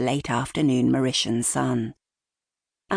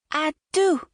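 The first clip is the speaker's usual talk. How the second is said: put-on high-pitched little girl; toddler daughter's voice; 12 semitones higher than usual. A narrator's reading. Toddler daughter handing coral to her helper, high baby voice. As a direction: say it as a tiny child, pitched way up. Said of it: "her daughter's voice saying".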